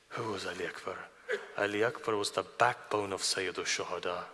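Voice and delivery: soft, low, intimate telling of a sacred story; hushed sermon narration. Acted. Preacher softly recounting Ali Akbar to the gathering, hushed. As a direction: draw the mourners in quietly.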